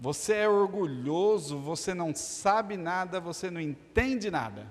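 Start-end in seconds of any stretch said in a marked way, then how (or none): none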